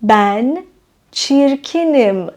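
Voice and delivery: çirkinim spoken very slowly, syllable by syllable; slow model sentence. Shown as a demonstration. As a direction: drill çirkinim slowly for the class. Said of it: "به معنی اینه که". (çirkinim را آهسته و شمرده بگو)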